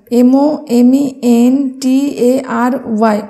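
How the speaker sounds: slow, separate letters; dictating the spelling slowly. Shown as a demonstration. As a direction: spell it out one letter at a time. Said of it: "আমি দেখো তোমাদের উচ্চারণ করে ভেঙে ভেঙে".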